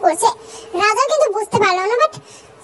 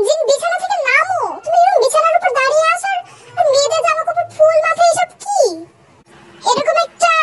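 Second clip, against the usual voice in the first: higher-pitched, exasperated scolding; scolding visitor; 6 semitones higher than usual. Acted. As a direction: tell off a silly prince, pitch raised. (বিরক্ত হয়ে চড়া সুরে বকুনি)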